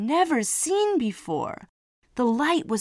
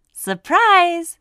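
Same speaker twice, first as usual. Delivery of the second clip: high, loud gleeful shout; spring the surprise with a grin; excited surprise; 9 semitones higher, 9 dB louder than usual. Acted. Mum springing the joke on Simon, loud, gleeful and high. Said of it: "said Mum with a huge grin".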